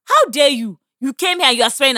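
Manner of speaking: indignant rebuke. Retold or imitated. Woman rebuking a stranger for swearing at them, indignant. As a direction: re-enact an outraged telling-off.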